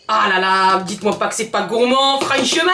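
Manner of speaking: loud southern French accent, rising at the end. Real, not acted. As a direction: let a southern French twang burst out. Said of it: "mon accent du Sud est en train de ressurgir".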